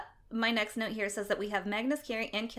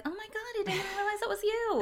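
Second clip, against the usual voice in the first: surprised; 12 semitones higher than usual. Retold or imitated. Surprised recognizing a friend.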